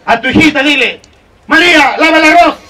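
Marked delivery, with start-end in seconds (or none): 1.5-2.6 high, raised household order; parent calling daughter. Acted. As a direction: call her to the chore. (llamando a la hija en voz alta)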